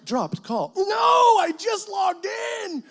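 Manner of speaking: high, loud, stretched-out mock cry of frustration; exasperated outburst. Young missionary yelling at the dial-up in the cafe, high, loud and drawn out. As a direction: wail at the dropped connection.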